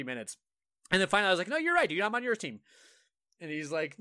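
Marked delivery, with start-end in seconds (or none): 1.5-2.6 high-pitched, over-eager giving in; mock agreement. Player placating an angry opponent, mock agreeable. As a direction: cave in at a much higher pitch. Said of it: "just start agreeing with them".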